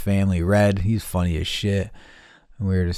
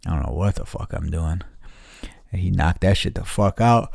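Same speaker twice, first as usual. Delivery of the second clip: normal talking voice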